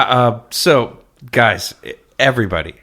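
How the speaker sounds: ordinary talk, nothing marked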